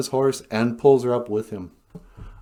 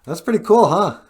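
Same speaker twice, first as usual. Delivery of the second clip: impressed; 9 semitones higher, 7 dB louder than usual. Retold or imitated. Acted onlookers admiring dancing wolf.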